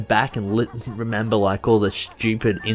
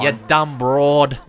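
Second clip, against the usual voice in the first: put-on old New York accent; 3 semitones higher, 4 dB louder, much slower than usual. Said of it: "the broad accent".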